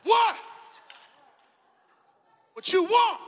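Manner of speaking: high, loud challenge; defiant shout. Retold or imitated. Man jumping out of the car, shouting a challenge. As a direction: bark at the thing in the fog.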